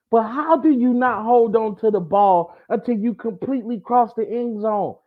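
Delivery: high-pitched incredulous rant; exasperated disbelief. Real, not acted. Host scolds a player who dropped the ball early, incredulous. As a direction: pitch it way up, baffled by the blunder.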